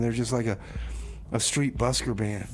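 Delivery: normal talking voice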